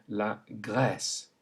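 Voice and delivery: slow la Grèce with open è; la Grèce slowly; French è. Shown as a demonstration. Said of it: "it can be a grave accent".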